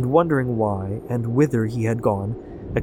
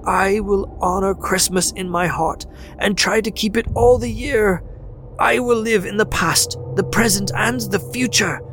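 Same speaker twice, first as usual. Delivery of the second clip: high, raised, fervent vows; agonized entreaty; 10 semitones higher than usual. A narrator's reading. Scrooge on his knees vowing to the spirit, high anguished entreaty. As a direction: swear it with desperate hope. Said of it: "In his agony".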